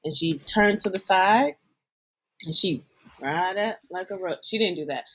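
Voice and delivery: low, measured play-by-play for listeners; voice commentator. Pretend. Cohost narrating a Rock impression for listeners, low commentator voice. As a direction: describe the pose like a deadpan commentator, low and deliberate. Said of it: "giving the voice commentator for the listeners".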